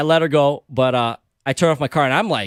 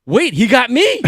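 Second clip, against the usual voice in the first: high, loud mock shock; sudden astonished yelp; retold disbelief; 9 semitones higher, 5 dB louder, slower than usual. Driver realising mid-call that he got pulled over, disbelief.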